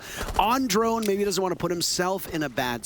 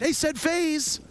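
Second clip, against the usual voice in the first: high, slow mocking echo of partner; 12 semitones higher, slower than usual. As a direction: parrot him in a high voice.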